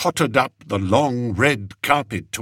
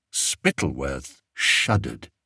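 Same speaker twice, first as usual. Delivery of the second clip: plain reading voice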